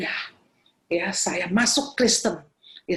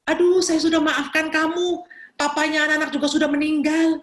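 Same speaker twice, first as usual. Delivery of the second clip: high, louder retold brush-off; exasperated reassurance; 7 semitones higher, 4 dB louder than usual. Preacher telling the caller it is long forgiven, acted exasperation. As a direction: wave the apology away, pitched up.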